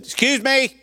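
high, pointed mock woman's voice; sharp, indignant excuse me; irritated woman calling him out; woman at pump. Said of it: "She said".

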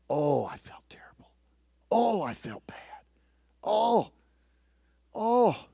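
whispered, close to crying; preacher reliving his guilt in a tearful whisper. Real, not acted. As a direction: whisper it as if choking up. Reliving guilt, near tears.

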